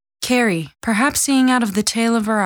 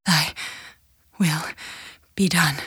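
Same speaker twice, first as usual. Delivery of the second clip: halting prayer; 3 semitones lower, 4 dB quieter than usual. A narrator's reading. Dying mother's halting prayer.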